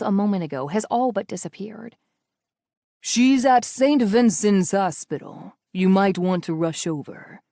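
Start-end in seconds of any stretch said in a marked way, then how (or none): 3.0-7.4 slow, smooth menace with a cruel smile; enjoy the threat slowly; sick amusement. A narrator's reading. Villain taunting Natalia about her aunt, amused and menacing. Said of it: "tinged with sick amusement".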